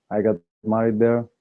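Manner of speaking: ordinary talk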